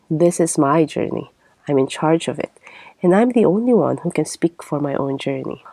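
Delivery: ordinary talk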